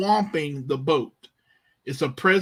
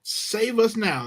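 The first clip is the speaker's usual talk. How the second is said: slow, pleading and higher; urgent plea; 5 semitones higher, slower than usual. Retold or imitated. Frightened disciples begging Jesus to save them, slow and urgent. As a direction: beg him to save them, drawn out. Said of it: "there's urgency here".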